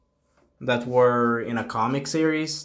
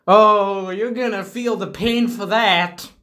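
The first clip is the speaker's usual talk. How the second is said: higher and louder, playful menace; mock threat; 7 semitones higher, 4 dB louder, slower than usual. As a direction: threaten them teasingly. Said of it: "And I'm like".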